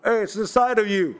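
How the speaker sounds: calling out loud. Retold or imitated. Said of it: "he's gonna speak loud".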